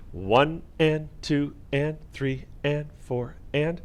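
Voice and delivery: slow, even count aloud. Shown as a demonstration. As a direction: count out each half beat slowly. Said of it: "We'll count it first".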